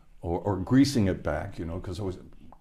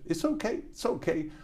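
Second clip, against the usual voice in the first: much higher, gentle quoted reassurance; quoting Bootsy; 10 semitones higher than usual. Retold or imitated. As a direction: lift way up to soothe the guard. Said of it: "and Bootsy said".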